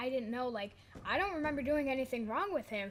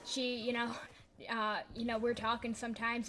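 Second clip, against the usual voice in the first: ordinary talk, nothing marked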